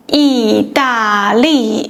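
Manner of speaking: 意大利 said slowly, each syllable apart; slow model word. Shown as a demonstration. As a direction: model the country name slowly. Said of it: "For instance".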